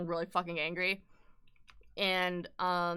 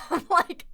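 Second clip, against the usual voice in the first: incredulous laugh; 6 dB louder than usual. Real, not acted. Laughing in disbelief.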